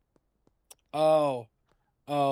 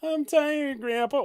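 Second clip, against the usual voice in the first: higher, louder mock complaint; whiny character voice; 13 semitones higher, 5 dB louder than usual. Hero whining to Grandpa while digging, higher mock voice. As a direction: whine it up high like a tired kid.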